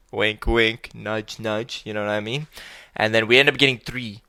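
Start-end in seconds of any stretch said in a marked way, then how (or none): none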